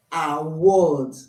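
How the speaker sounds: ordinary delivery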